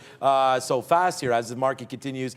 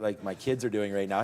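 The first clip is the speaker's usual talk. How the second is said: ordinary talk, nothing marked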